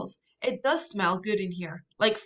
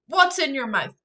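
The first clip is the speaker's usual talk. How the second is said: higher, louder, fast alarmed questions; 6 semitones higher, 8 dB louder than usual. Acted. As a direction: fire questions in a panic. Alarmed sister questions brother.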